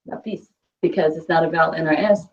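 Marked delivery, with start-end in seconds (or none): 0.1-0.5 "Lápiz" with the stress moved to the end, la-PEEZ; stress on final syllable. Shown as a demonstration. Teacher showing where natural stress falls, final-syllable stress on "Lápiz". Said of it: "a natural stress will fall there".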